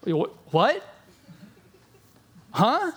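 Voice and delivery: a mock baffled what, huh rising high; stunned. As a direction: sputter in disbelief. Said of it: "are all of a sudden like".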